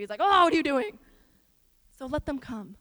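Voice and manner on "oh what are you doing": loud, higher exasperated outburst; frustrated. Retold or imitated. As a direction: burst out as an exasperated teacher to his followers. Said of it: "he was so frustrated".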